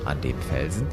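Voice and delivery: ordinary delivery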